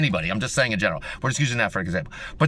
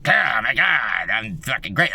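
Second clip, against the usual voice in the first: slow, lower, hammed-up boast; mock a smug braggart, drawn out; mock self-praise; 4 semitones lower, slower than usual. Mocking someone who brags about themselves, slow and hammed-up. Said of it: "I'm not like".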